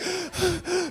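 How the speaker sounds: sharp high gasp for air; exhausted gasp. Retold or imitated. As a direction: gasp like you can't breathe. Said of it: "I'm like".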